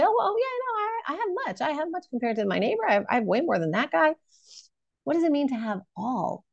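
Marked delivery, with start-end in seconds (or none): 0.0-4.1 mock boasting. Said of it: "And then you say".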